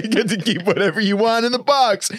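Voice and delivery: can't keep a straight face telling it; laughing through the quote. Retold or imitated. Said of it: "he was like".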